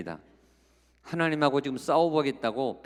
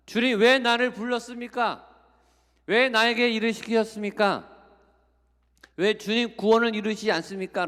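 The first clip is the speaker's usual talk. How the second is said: angry complaint; 8 semitones higher, 4 dB louder than usual. Retold or imitated. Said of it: "몹시 화를 냈어요".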